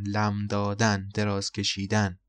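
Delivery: normal talking voice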